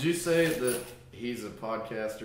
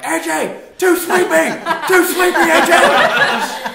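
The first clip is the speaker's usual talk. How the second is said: shrill high screaming with laughter, much higher and louder; screaming fan; 15 dB louder, slower than usual. Retold or imitated. Wife screaming at a wrestler from the crowd, laughing high yell. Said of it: "Nikki's screaming her lungs out".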